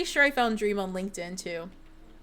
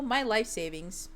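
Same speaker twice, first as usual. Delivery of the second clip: ordinary talk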